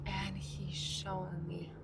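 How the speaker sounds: hushed secret aside; whisper. Real, not acted. Telling viewers a secret, whispered. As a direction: lean in and whisper it. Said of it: "whisper heard".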